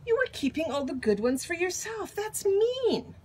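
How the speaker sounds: put-on high child's complaint, rising to the end; indignant protest. Leo accuses Stillwater of keeping good cookies, indignant. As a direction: protest the unfairness, pitch climbing.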